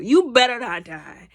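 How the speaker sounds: loud insistent plea; loud warning plea. Real, not acted. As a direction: warn him loudly not to die. Pleading with Spider-Man on screen.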